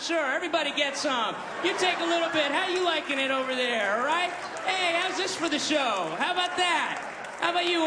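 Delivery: high, cocky showman voice; the elephant gloating as it sprays everyone; elephant showing off. Pretend. Elephant spraying the crowd, high and gloating.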